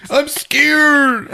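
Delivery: very high frightened imitation; hammed-up fearful high voice; mock scared son.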